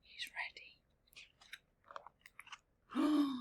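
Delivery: breathy excited whisper to listeners; whispered suspense; hushed whisper. Real, not acted. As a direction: whisper it like a secret before the dive. Whispered aside while story runs.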